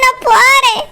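normal talking voice

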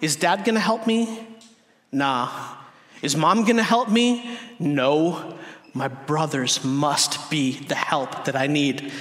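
lightly acted child weighing her options; daughter's inner reasoning. Toddler deciding who will help her, lightly acted deliberation. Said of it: "And she, and she goes from".

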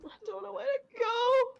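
high whimpering panic, close to crying; pleading, lost and scared; near tears. Real, not acted.

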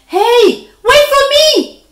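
high, loud call after the birds. A narrator's reading. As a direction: yell up into the sky. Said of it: "he called".

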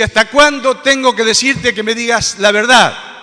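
loud, high furious shout; angry outburst. Acted. King Acab demanding truth from the prophet, angry shout. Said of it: "entonces Acab se enoja".

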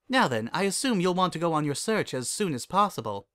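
lifted regal character voice; Celestia, composed princess. Acted.

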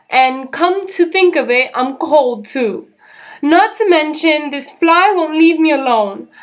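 high, loud whining list; whiny complaining. Acted. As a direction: grumble about every misery. Jim whining about everything.

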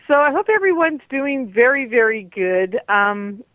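ordinary talk

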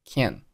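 "can" for can't, final t held back; unreleased t. Shown as a demonstration. Teacher showing learners a silent stop, "can" without released t. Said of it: "结尾的t没有爆出来".

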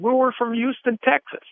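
put-on Texan accent. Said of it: "I noticed that they had this accent".

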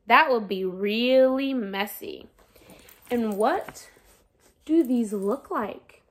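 lively picture-book read-aloud voice; reading to children. Acted. Teacher reading a sun picture book to her students, lively storytelling. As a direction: read it like story time for a class.

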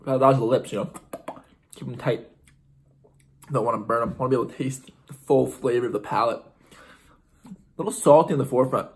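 muffled words between bites; chewing while talking. Real, not acted. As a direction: mouth partly full. Seen 2: Bites pizza before; chewing motions and pursed lips throughout speech.

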